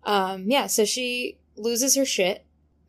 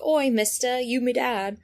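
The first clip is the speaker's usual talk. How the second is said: higher-pitched mock Cockney; 3 semitones higher than usual. Imitating the book's very British translation, mock Cockney. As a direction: do a cheeky Cockney caricature. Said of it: "she is so Cockney".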